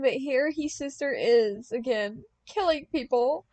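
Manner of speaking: understated sob story, high and loud; mild wailing. A narrator's reading. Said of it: "Snowman sobbed and wailed".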